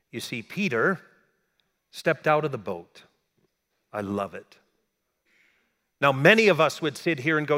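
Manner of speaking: usual speaking voice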